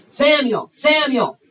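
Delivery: "Samuel" called high and loud. Acted. God calls the boy a second time, calling loudly. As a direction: summon the sleeper insistently.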